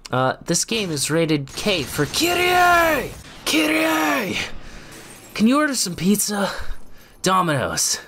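high overdramatic yelling hero; dramatic Nero. Pretend. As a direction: yell everything like a melodrama. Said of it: "dramatic Nero".

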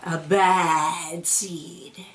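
drawn-out, ominous refrain; slow ominous. A narrator's reading. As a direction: stretch each word out darkly. Refrain of the bad seed.

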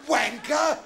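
high, loud incredulous echo; outraged disbelief. Acted. Dad appalled at donkey's name, shouting in disbelief.

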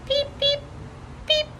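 very high squeaky peeps; tiny peep. A narrator's reading. Lucille peeping in the dark, tiny and high. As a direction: chirp it like a baby bird.

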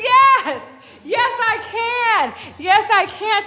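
high, loud, sure yes I can; triumphant self-belief; confident yes I can. Retold or imitated. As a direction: declare it with conviction. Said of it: "Or you can say".

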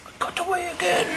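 very high, fast gleeful villain; sneering panto villain. Acted. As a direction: crow about the escape. Villain escapes once more.

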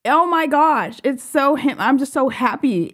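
ordinary talk, nothing marked

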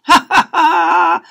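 high, slow, loud jeering laugh; mocking kids' laughter. Schoolkids laughing at the family, loud jeering laugh. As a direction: cackle at the pink hearts. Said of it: "the kids burst out laughing".

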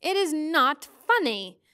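put-on childish indignation. A narrator's reading. Charles scolds Mrs Which, childishly indignant. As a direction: spell it out like a cross child. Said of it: "gave a childish stamp of his foot".

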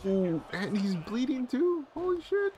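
high, slow astonished reaction; shocked. Real, not acted. Viewer seeing he's bleeding too, shocked. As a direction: voice climbing in disbelief.

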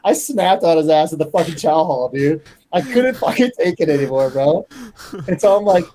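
usual speaking voice

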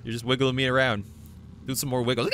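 ordinary talk, nothing marked

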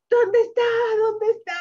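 high, loud eager question; excited asking. Acted. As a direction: call out searching eagerly. Excited search in picture book.

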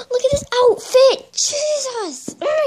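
high, loud gasp of delight. Real, not acted. Thrilled unboxing, squealing at a gifted doll outfit. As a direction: squeal over the cute outfit.

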